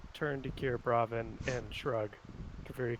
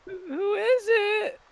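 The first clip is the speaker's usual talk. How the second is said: loud, slow, squeaky sing-out; very high-pitched voice; 8 dB louder, much slower than usual. Acted. Pickle asking who is at the door, high-pitched.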